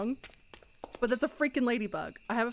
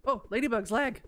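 ordinary delivery

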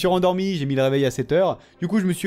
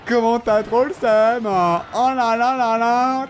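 drawn-out teasing, higher and louder; slow mocking taunt; 7 semitones higher, 4 dB louder, slower than usual. Real, not acted. Gamer taunting the beaten opponent, slow mock. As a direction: stretch the words out to rub it in.

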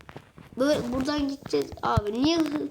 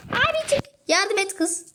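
much higher, pleading abi çık; high urgent plea; 7 semitones higher than usual. Real, not acted. Gamer urging his character out, high pleading squeal.

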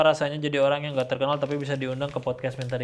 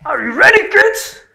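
very high, loud cartoon captain yell; hammed-up squeaky captain call; SpongeBob intro captain; 14 semitones higher, 16 dB louder, slower than usual. Shown as a demonstration. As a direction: shout it like the cartoon pirate. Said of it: "Tiruin suara intro SpongeBob".

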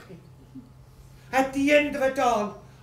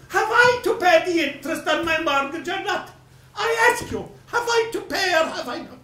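louder and higher, pressing hard; indignant demand; 5 semitones higher, 5 dB louder than usual. Acted. Creditor demanding his interest from the widow, indignant. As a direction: hammer the question at her.

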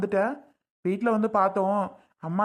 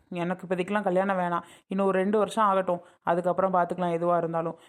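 ordinary talk, nothing marked

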